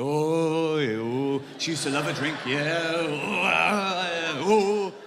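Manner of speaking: imitated drawn-out oohs of realisation; knowing chorus. Friends guessing at a pregnancy, mock knowing oohs. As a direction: play a roomful of friends catching on. Everyone reacting knowingly to hints.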